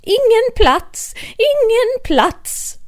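very high, loud, slow cry; shrill-free high loud protest; shouted together. Acted. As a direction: shout the table is full. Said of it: "ropade de".

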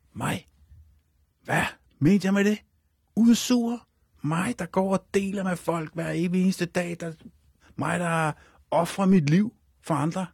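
mock outrage, each claim stretched slowly; indignant outburst. Retold outraged reply to Mogens.

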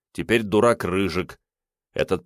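ordinary delivery

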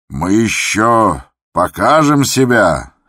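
loud, high, drawn-out bravado; booming jolly confidence; boisterous. A narrator's reading. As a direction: boast heartily before bursting into laughter. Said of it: "захохотал Михаил Аверьяныч".

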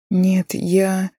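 voice breaking into moan. A narrator's reading. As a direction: let the voice crack into a moan. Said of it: "Голос Яны срывается на стон". (голос срывается на стон)

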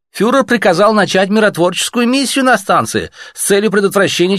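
a bureaucrat brushing off visitors at night; cool Reich officer. Acted. Reich officer answering the messengers, officious.

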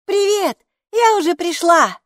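high, loud ringing shout; calling out. A narrator's reading. Хилла shouts toward the bathroom, high loud call. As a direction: holler a greeting across the flat. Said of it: "крикнула в сторону ванной".

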